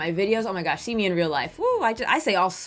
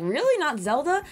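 higher-pitched incredulous questioning; mock disbelief; 7 semitones higher than usual. Vlogger voicing doubting viewers about her favorite game, in mock disbelief. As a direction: imitate viewers who can't believe it, pitched up. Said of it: "some of you guys are like".

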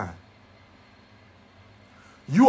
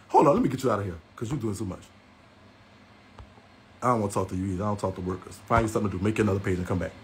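low dismissive aside; 11 semitones lower, 8 dB quieter, faster than usual. Real, not acted.

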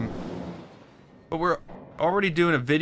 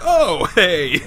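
loud, higher delighted greeting; suddenly friendly police; 5 semitones higher, 10 dB louder than usual. Retold or imitated. Police hearing the tourists are Canadian, suddenly loud and friendly. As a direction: burst out with a big, welcoming cheer. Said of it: "as soon as we said Canadian, they're like".

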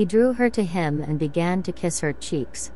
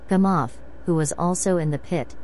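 plain reading voice

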